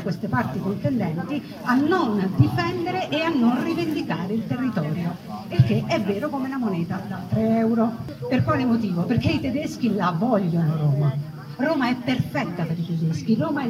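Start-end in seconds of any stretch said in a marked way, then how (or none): none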